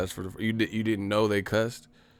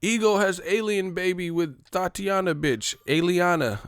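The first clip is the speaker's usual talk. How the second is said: higher, announcing mock headline; tabloid headline; 10 semitones higher, 3 dB louder than usual. Streamer mocking a gossip headline, higher announcing voice. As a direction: cry it out like a tabloid.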